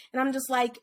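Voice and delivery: normal talking voice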